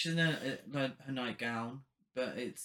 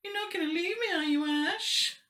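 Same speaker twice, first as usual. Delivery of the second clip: put-on camp taunting possessed girl; mock Cheryl voice; 3 dB louder than usual. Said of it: "Cheryl is just like".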